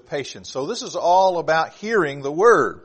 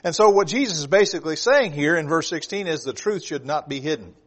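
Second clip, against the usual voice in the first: normal talking voice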